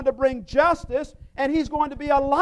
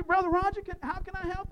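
softer, caring voice; tender offer to help an injured brother; gentle offer of help; 5 semitones higher, 4 dB quieter, faster than usual. Pretend. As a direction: speak tenderly to a hurting friend. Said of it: "It's like helping Brother Roger".

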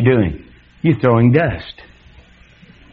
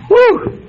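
high hammed-up whoop; a big scandalised woo; mock whoop. Preacher mocking gossip about the woman, high mock whoop. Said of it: "I might have said".

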